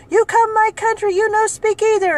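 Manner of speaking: high, loud, snippy broken English; rude customer. Retold or imitated. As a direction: imitate her scolding. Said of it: "she had a bad attitude with me".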